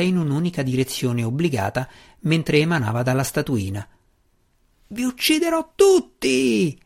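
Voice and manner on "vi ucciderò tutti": high, slow, wild shriek; shrieking wizard. A narrator's reading. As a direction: screech the threat like a frantic wizard. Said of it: "gridò il mago invisibile".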